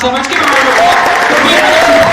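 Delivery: rally the audience; teacher calls the room to clap; calling for applause. Real, not acted.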